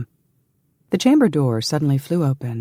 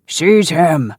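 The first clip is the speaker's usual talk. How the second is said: loud, raised order to the guards; ringing command; 5 semitones higher, 6 dB louder than usual. A narrator's reading. Hugh orders his guards to seize an intruder, ringing command. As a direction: call it out forcefully. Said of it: "Hugh's deep voice rang out".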